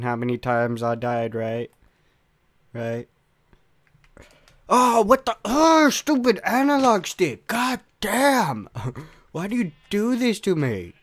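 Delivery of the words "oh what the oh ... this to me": high, loud frustrated yelling; raging outburst. Real, not acted. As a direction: rage at the game at full voice. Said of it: "So much rage".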